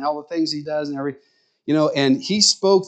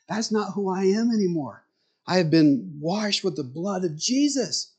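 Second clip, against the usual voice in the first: higher, an earnest lightly voiced friend; retelling Steve's testimony in his raised, earnest voice; voicing Steve; 6 semitones higher, 3 dB quieter than usual. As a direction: let Steve's new-creation conviction lift the pitch.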